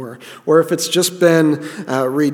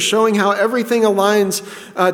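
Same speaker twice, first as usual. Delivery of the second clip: usual speaking voice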